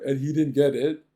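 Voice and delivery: ordinary delivery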